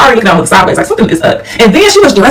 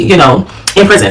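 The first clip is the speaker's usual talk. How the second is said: ordinary talk, nothing marked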